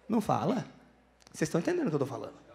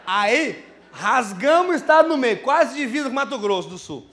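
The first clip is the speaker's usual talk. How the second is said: slow, loud and hammered out; emphatic excitement; 2 semitones higher, 6 dB louder, slower than usual. Real, not acted. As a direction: stretch each word, fired up about the trip. Hyped retelling of the road trip. (contando a viagem, alto e arrastado, empolgado)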